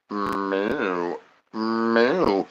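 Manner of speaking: quiet mooing like a cow; soft cow moo. Acted.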